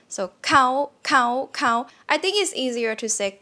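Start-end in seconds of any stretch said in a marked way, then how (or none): 0.1-1.9 "cow" pitched higher. Shown as a demonstration. Teacher demonstrates the Thai high tone. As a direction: lift "cow" to a high level tone. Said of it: "this one is high tone".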